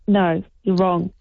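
normal talking voice

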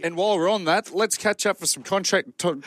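tripping over the words; can't get it out; stumbling. Real, not acted. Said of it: "You can't even spit it out".

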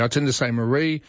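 ordinary delivery

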